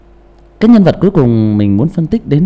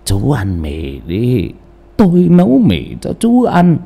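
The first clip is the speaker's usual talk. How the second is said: put-on wheezy old voice; slower than usual. Shown as a demonstration. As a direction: wheeze it out like a frail old man. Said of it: "Đổi giọng thành khèo khào". (giọng ông già khèo khào, chậm và yếu)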